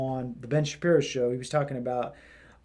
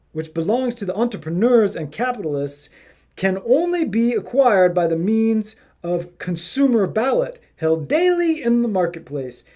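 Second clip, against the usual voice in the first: put-on hoity-toity English accent, higher and louder; 5 semitones higher, 5 dB louder than usual. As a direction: read it like a posh Englishman. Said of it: "I gotta do this in the English accent".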